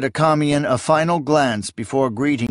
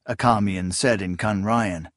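plain reading voice

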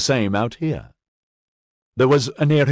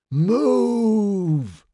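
much higher shouted order; urgent warning shout; 12 semitones higher than usual. A narrator's reading. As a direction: yell to get them clear. Sergeant warns of falling body.

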